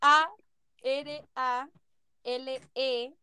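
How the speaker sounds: slow, piece-by-piece spelling with pauses; por sílabas. Real, not acted. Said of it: "Lo estáis haciendo por sílaba". (deletreando lento, pedacito por pedacito)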